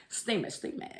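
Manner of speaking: whisper. Real, not acted. Recalling steamy shower scene.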